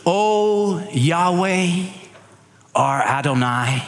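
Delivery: reverent Hebrew pronunciation of Yahweh and Adonai; Hebrew names. Shown as a demonstration. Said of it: "He said this in Hebrew".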